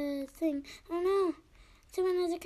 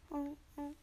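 ordinary talk

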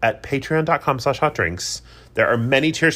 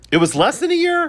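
surprised; 5 dB louder than usual. Real, not acted. Shocked at the timeline.

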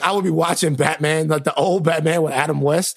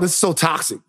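ordinary talk

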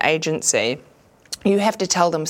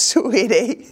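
ordinary delivery

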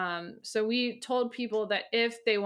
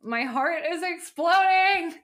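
high, loud, drawn-out gush; gushing outburst; 8 semitones higher, 6 dB louder, slower than usual. Acted. Host reading a fan's response, gushing loudly. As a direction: burst out with overjoyed emphasis.

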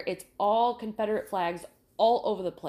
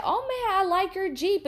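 much higher mock admiring voice; imitating the husband; 11 semitones higher, 4 dB louder than usual. Husband admiring the new car, high mock voice. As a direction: gush over the car like a local. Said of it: "and he said".

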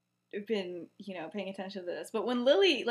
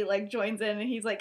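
usual speaking voice

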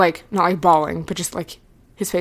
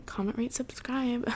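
joking whisper; 4 semitones higher, 11 dB quieter than usual. Pretend. Joking outro plea to viewers.

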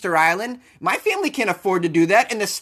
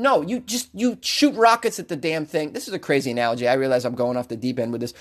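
normal talking voice

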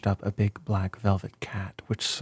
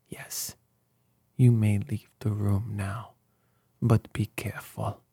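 ordinary delivery, nothing marked

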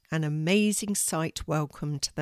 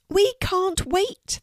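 high, drawn-out squeal of glee; excited squeal; 13 semitones higher, slower than usual. A narrator's reading. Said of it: "they squealed together".

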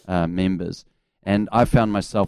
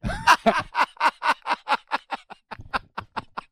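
high shrieking laugh. Real, not acted. Laughing at his own story of snubbing Kevin Rudd. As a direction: crack up at the memory.

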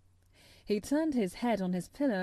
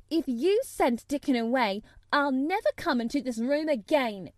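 higher, louder threat; angry retort; 4 semitones higher, 6 dB louder than usual. A narrator's reading. Mary threatening Colin over Dickon, angry retort. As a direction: fire it back stubbornly. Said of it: "she retorted".

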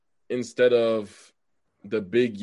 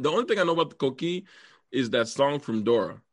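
ordinary talk

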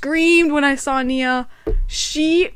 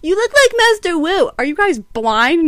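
mocking kid voice; 6 semitones higher, 4 dB louder than usual. Voicing kids, mocking.

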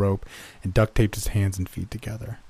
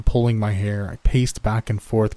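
ordinary delivery, nothing marked

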